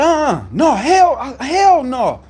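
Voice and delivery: high, loud refusal; angry yelling. Retold or imitated. Him refusing to calm down for the manager, loud yell. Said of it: "As soon as I start yelling".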